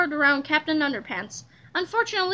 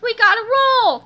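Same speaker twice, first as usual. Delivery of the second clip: high, loud call to go; urgent cry; 7 semitones higher, 7 dB louder than usual. A narrator's reading. George rushing Harold off, crying out urgently. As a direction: urge a friend to hurry. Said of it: "cried George".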